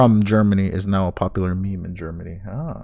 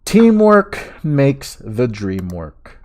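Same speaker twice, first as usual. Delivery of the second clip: slow emphatic; 3 semitones higher, 7 dB louder, much slower than usual. Real, not acted. Cheerful thanks to chat.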